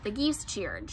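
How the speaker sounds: ordinary delivery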